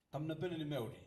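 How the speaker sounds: called out high and loud to rouse the hall; loud repeated greeting. Shown as a demonstration. Speaker greeting the assembly a second time, loud and high. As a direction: belt the Kiribati greeting again so they answer back. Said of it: "I greet you".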